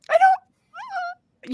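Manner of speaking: high, breaking voice, close to crying; choked up. Retold or imitated. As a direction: squeeze the words out through tears. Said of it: "I had to put it away 'cause I was like".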